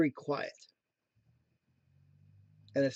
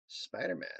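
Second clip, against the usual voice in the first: low, soft musing; pondering aside; 12 semitones lower, 9 dB quieter than usual. Retold or imitated. Speaker mulling over a cat mask, low pondering murmur. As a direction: murmur it low, as if considering a purchase. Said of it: "So I'm like, "Hmm".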